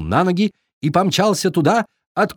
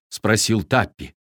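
ordinary delivery

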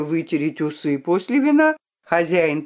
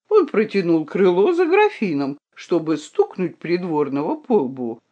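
ordinary delivery

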